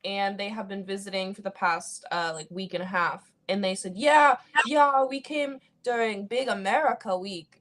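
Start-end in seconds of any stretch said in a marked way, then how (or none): 3.9-7.6 higher, slow mock German accent; imitating German grandparents' accent. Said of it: "I'm gonna stop doing the German accent".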